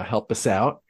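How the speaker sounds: usual speaking voice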